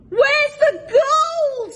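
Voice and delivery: very high, loud, slow bellow; angry pirate captain shouting down into the hole; furious shout. A narrator's reading. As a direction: roar it down at the troll. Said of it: "shouted Handkerchief".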